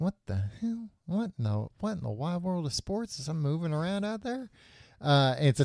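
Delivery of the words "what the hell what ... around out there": hushed, higher-pitched puzzlement; mock bafflement. Hero spotting movement in the desert, softly baffled. Retold bafflement at something moving.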